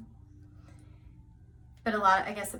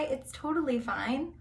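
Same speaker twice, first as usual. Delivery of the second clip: ordinary delivery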